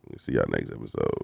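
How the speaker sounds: deep, soft seductive voice; sexy voice. Shown as a demonstration. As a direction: go way down and soft, sultry. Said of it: "how sexy my voice got right there".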